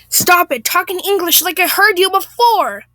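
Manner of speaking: hammed-up irate demand; exasperated Karen. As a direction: order them crossly, voice climbing. Acted Karen quarrelling.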